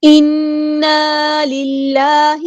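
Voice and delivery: slow, drawn-out melodic tajweed recitation; Quran recitation. Shown as a demonstration. Teacher recites the verse for students, slow melodic chant. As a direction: chant each word slowly in tajweed.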